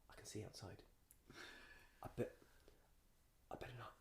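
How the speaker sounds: very quiet, halting self-talk; acted-out wary near-whisper; hushed inner thought. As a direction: murmur a private thought to yourself. Acting out nervous private thoughts.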